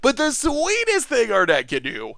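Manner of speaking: ordinary talk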